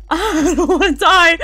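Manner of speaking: loud, high wail through nervous laughter; panicked laughing. Real, not acted. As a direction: laugh while crying out in fright. Scared, laughing in horror game.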